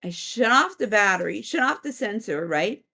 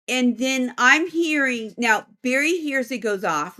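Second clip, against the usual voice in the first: ordinary talk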